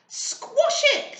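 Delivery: high, loud scream; shrieking squash it. A narrator's reading. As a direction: yell it out like frightened children. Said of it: "screamed everyone".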